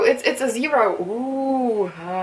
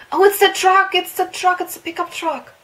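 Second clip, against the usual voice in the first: much higher, loud excited cry; excited recognition; 11 semitones higher, 6 dB louder than usual. Real, not acted. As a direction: shout it as you spot it. Spots the truck in game.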